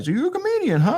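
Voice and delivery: lightly put-on high voice for a chatty guy; party bus guy. Party bus guy asks the comedian, high-voiced imitation.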